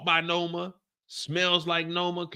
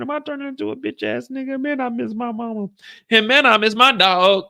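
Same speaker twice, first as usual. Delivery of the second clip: mock crying; 9 semitones higher, 6 dB louder than usual. [name] crying over perfume.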